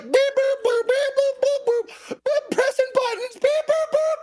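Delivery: put-on very high childish beep-boop chant; playful little kid pressing buttons; four-year-old child. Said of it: "a four-year-old child who was just like".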